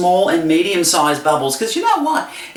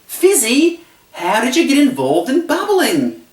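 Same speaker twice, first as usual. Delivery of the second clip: curious audience question; 8 semitones higher than usual. Retold or imitated. Host hinting that the crowd should ask him, high and curious. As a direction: prompt them by imitating eager askers. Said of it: "for people to ask me".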